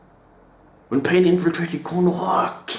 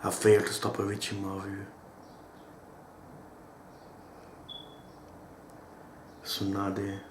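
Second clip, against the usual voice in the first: reciting a death speech; 9 semitones lower, 14 dB quieter than usual. Retold or imitated. Fan quietly reciting Jiraiya's last words, hushed and solemn. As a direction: let each line fade out quietly like a last breath. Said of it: "Jiraiya's death speech".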